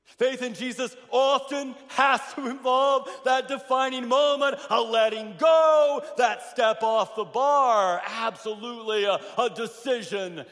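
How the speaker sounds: loud, high preacher's build to a shout; preaching at full force. Acted. Pastor urging the congregation to let go, loud and rising preaching. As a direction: drive it home from the pulpit.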